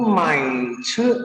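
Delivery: Mandarin "mai ce", low tone then level tone; third then first tone. Shown as a demonstration. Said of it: "Nada ketiga dan pertama".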